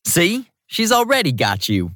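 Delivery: lightly amused and pleased; smiling. A narrator's reading. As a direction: tease with a smile in the voice. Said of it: "he said with a smile".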